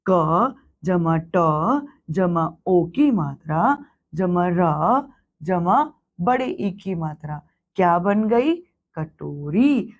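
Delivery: slow dictation of letters, then कटोरी; slow spelled-out sum. Shown as a demonstration. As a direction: build कटोरी piece by piece, slowly. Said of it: "अब देखिए अगला".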